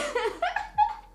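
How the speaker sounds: squealing high laugh; high burst of laughter. Real, not acted. Laughing at her own imagined paranoia, high squeal. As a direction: let out a high, helpless laugh.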